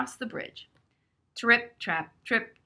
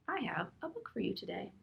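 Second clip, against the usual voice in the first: whisper; 11 dB quieter, faster than usual. Real, not acted. Reading aloud to children, asides.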